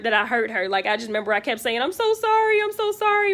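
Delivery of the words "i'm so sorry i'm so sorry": high, loud, frantic cry of sorry; imitating her own distressed plea; panicked apology. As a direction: beg forgiveness in a panic.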